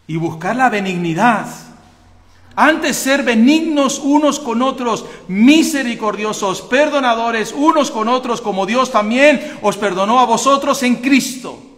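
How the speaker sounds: loud, high pulpit cry; fiery preaching. Acted. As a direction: thunder the scripture at the church. Preaching at full force.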